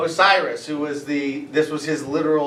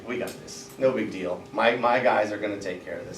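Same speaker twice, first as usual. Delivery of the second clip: inner thought; 6 semitones lower, 7 dB quieter than usual. Acted. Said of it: "Internally, he's like".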